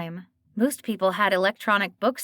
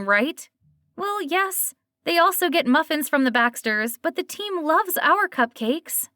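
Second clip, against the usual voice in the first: hurt disbelief; 7 semitones higher than usual. A narrator's reading. Dana hurt outrage.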